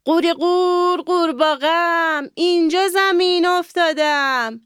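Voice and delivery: high, sing-song frog calling out; a small frog's high, chanted call; frog's croaky call. A narrator's reading. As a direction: croak it out like a little frog crying for help. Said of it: "یه دفعه فریاد زد".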